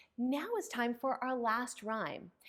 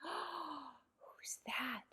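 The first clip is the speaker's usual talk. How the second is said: whisper; 12 dB quieter than usual. Pretend. Puppet reveal to children.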